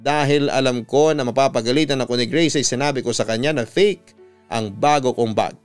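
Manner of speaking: usual speaking voice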